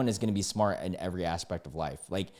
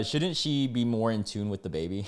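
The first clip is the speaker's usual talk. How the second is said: normal talking voice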